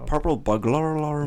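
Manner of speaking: hammed-up Celtic lilt on "Purple burglar alarm."; accent sounding Irish. Shown as a demonstration. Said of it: "It just sounds Irish".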